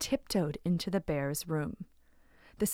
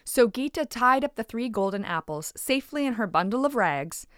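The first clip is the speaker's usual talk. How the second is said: ordinary delivery, nothing marked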